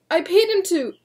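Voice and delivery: high loud desperate cry. Acted. William protesting to the deputy, desperate cry. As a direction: protest until cut off.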